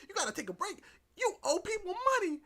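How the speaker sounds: high voice rising into a loud rebuke; mock scolding. [name] reminding Doki of money owed, high mock-indignant voice. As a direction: throw back the excuse, then scold.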